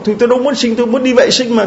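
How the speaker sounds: high-pitched retold pleading; distressed protest. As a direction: protest your innocence in a high voice. Mother defends herself to the doctor.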